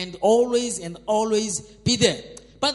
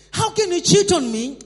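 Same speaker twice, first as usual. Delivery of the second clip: higher and louder, half-laughing accusation; mock outrage; 6 semitones higher, 6 dB louder than usual. Cheating husband turning it around on his wife, mock outraged and laughing.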